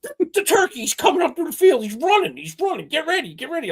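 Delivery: high-pitched, urgent retold warning; frantic alert. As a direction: imitate a panicked heads-up, voice shooting up. Acting out friend spotting the turkey.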